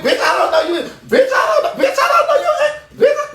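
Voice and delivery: high, laughing shout; playful high yell; mock shriek. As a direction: squeal it with a laugh.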